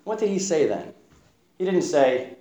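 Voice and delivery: ordinary talk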